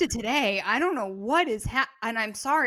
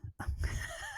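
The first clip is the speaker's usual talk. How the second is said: wheezy laugh; 9 dB quieter than usual. Real, not acted. High silent laugh.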